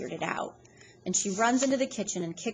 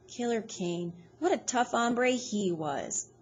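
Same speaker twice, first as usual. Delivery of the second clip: slow, gruff-toned admiration; slow, admiring tough guy; 4 semitones higher, slower than usual. A narrator's reading. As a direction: savor the legend slowly. Iggy admiring a tough con.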